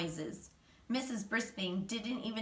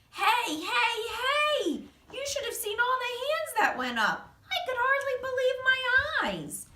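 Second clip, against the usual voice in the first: much higher, thrilled little hamster voice; excited squeak; 4 dB louder than usual. A narrator's reading. Hamster sees every hand go up, squeaky and thrilled. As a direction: bubble over with glee at all the hands.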